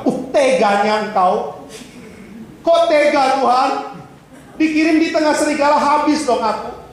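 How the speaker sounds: high, slow, plaintive mock complaint; hammed-up sheep begging God not to send it; whining sheep. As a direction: whimper like a sheep sent to the wolves.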